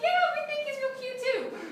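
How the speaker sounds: hammed-up girly enthusiasm; gushing friend. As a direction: squeal along like a supportive friend. Voicing an approving friend's imagined reply.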